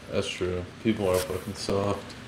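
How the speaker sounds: ordinary talk, nothing marked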